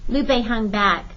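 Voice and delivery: ordinary delivery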